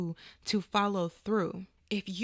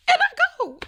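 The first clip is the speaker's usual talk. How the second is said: very high, loud mock outburst; comic squeal; 11 dB louder, faster than usual. Mother retelling being let go on the phone, comic high yelp.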